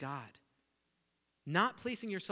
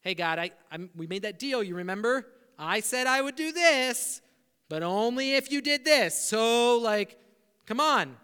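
pushy deal-maker; 6 semitones higher, 12 dB louder than usual. Retold or imitated. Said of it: "turn God around".

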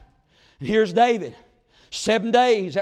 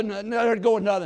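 usual speaking voice

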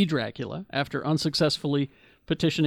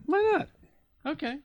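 studio execs; 10 semitones higher than usual. Retold or imitated. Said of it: "and they said".

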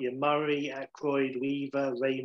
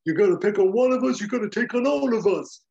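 loud, high, overblown hero speech; hammed-up superhero line; heavy-handed Spider-Man; 8 semitones higher, 8 dB louder than usual. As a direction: deliver it like a corny rallying cry. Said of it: "Really heavy-handed Spider-Man".

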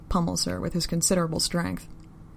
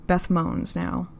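ordinary delivery

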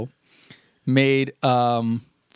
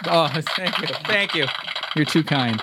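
mock humble star; 3 semitones higher than usual. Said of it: "The audience is applauding the system".

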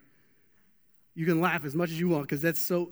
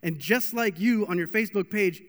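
normal talking voice

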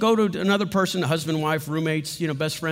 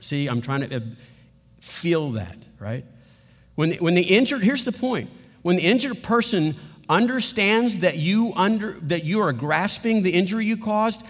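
normal talking voice

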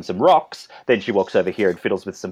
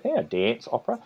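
broad Australian accent, high rising tune; 14 semitones higher, 7 dB quieter than usual. Shown as a demonstration. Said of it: "here in my Australian accent".

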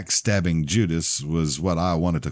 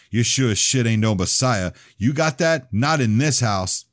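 higher, louder threatening bark; angry shout; 7 semitones higher, 5 dB louder than usual. Acted. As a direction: square up and yell him down. Ronnie squaring up at the table.

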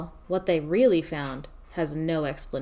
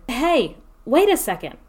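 higher, louder protest; calling out; 7 semitones higher, 7 dB louder than usual. Acted. Scientist stopping the colonel from leaving, loud call. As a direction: call out to stop them. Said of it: "I said".

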